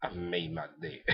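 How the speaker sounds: very soft gloat on the edge of laughter; hushed troll taunt, about to crack up; smug trolling. Retold or imitated. Recapper imitates Ogami walking off, quietly trolling. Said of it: "with that troll face".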